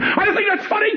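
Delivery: very high indignant cry; mock mother. Comedian mimics his mother objecting to the joke, very high indignant. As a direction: scold like an offended old mother. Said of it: "my mother's the type of woman".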